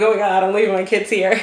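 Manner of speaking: half-laugh, half-panic about leaving; nervous laugh. Retold or imitated. Mom leaving her kids behind, nervous laughing. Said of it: "I'm like".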